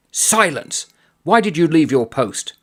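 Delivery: a hint of a furious major cutting him off; barked order. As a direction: major silencing a cowardly gunner, mock bark. Major shouting at the soldier.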